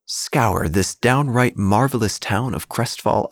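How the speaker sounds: ordinary delivery, nothing marked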